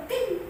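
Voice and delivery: high chime-like "ting"; phone ping. Retold or imitated. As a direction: imitate the ping of a message. Imitates a phone notification. (menirukan bunyi notifikasi SMS)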